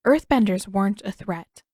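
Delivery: usual speaking voice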